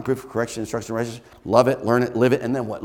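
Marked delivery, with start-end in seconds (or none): none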